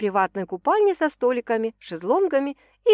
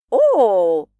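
ironic drawn-out oh; 9 dB louder than usual. A narrator's reading. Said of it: "иронично протянула Уля".

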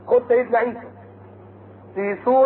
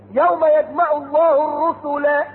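slow, higher melodic chant; Quran recitation; 4 semitones higher, much slower than usual. Acted. Preacher reciting the verse of the gathered messengers, chanted. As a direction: intone like a reciter.

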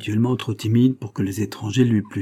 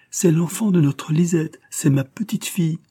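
higher, rising emotion of a grandmother; moved mother; 8 semitones higher, 4 dB louder than usual. A narrator's reading. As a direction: let the joy break through. (grand-mère émue, voix plus aiguë)

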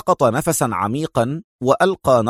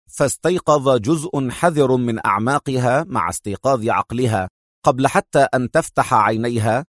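plain reading voice

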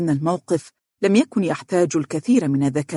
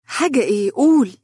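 a keen, pressing higher ask, rising louder at the end; eager interest; 8 semitones higher, 4 dB louder than usual. A narrator's reading. Dunya presses Fares to tell her news, eagerly. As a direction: turn to him all ears, urging him on. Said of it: "التفتت إليه باهتمام قائلة".

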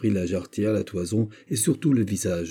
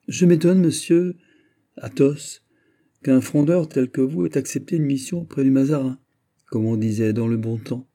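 higher, louder teasing needle; malicious glee; 5 semitones higher, 4 dB louder than usual. A narrator's reading. Mazarin gleefully mocking the old frondeur. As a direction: savour every barb. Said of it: "tout hérissé de pointes malicieuses".

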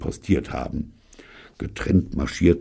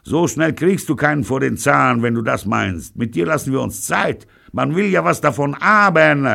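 shouting across; 12 semitones higher, 6 dB louder than usual. A narrator's reading. Pirolo shouts across street.

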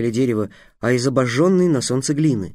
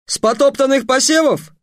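much higher, incredulous question; outraged surprise, voice jumping up; indignant disbelief; 11 semitones higher, 4 dB louder than usual. Acted. Official indignant.

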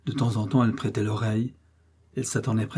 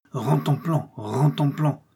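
rhythmic drum-like chant, raised in pitch; imitated drum roll; 3 semitones higher, slower than usual. A narrator's reading. Boy marking time with his heels, imitating a drum. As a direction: beat out the drum with the mouth. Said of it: "imitait tour à tour avec sa bouche le son du tambour".

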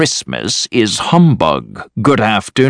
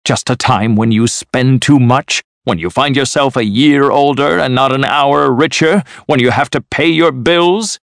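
indignant tirade, pitched up; angry rant; 6 semitones higher than usual. A narrator's reading. Uncle ranting at his nephew about Christmas, angry and indignant. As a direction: rail crossly with a raised pitch. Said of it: "Of course I'm angry".

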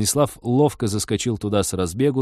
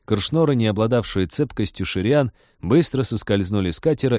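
ordinary delivery, nothing marked

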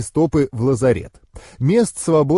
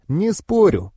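higher, playful agreement; amused concession; 7 semitones higher, slower than usual. A narrator's reading. Alina conceding to her boyfriend, lightly amused. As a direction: give in with a light tease. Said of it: "усмехнулась Алина".

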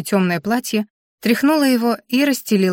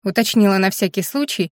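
ordinary delivery, nothing marked

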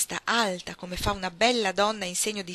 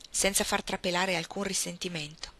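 plain reading voice

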